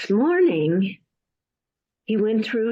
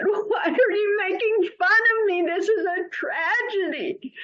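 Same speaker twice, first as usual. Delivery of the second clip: high, crying protest; hurt, tearful outcry; 12 semitones higher than usual. Acted. Abdullah protesting to the mocking neighbor, tearful shout. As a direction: cry out, wounded and sobbing. Abdullah feels mocked, weeping.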